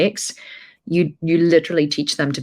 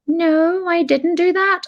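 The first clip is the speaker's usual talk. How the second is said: put-on much higher whiny kid voice; a child fibbing to their mum; child denial; 14 semitones higher, slower than usual. Her kids denying.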